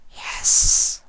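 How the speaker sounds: low, hushed breathy confession; whispered changeling. A narrator's reading. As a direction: whisper the truth. Sympathy admits.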